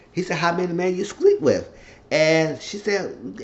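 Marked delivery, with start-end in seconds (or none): 0.4-1.7 high, insistent repeated question; acted accusation. Man pressing the woman about other men, insistent. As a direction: press her for an answer, pitched up.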